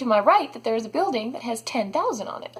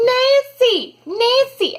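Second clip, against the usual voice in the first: loud, high call across the hall; 12 semitones higher, 5 dB louder, much slower than usual. Retold or imitated. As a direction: call after the ladies walking away. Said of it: "This lady is like".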